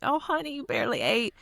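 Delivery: high-pitched fussing grandmother; lightly mocked grandmotherly concern; imitating a doting Nana. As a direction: coo it high, coaxing someone to eat. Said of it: "she would love to make you eat".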